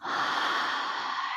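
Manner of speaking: long breathy release sigh; open-mouth sigh. Real, not acted. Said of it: "open the mouth and release any sound".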